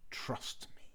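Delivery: soft sly fox whisper. Acted. The sly fox coaxes the cheese man in a whisper. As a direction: lure him in with a hush. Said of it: "said the fox".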